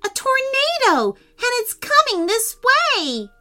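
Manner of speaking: high, alarmed cry; urgent alarm. Acted. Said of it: "said Prince Henry urgently".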